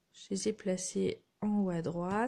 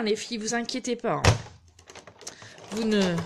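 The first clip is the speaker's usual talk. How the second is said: ordinary talk, nothing marked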